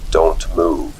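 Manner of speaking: flat, low and toneless; monotone voice. A narrator's reading. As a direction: speak like someone still asleep. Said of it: "in a monotone voice".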